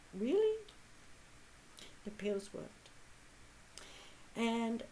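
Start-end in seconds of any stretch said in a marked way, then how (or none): 0.1-0.6 high, loud disbelieving Really?; a burst of real astonishment; astonished. Retold or imitated. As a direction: leap up in sudden surprise. Astonished at friend's offer of pills.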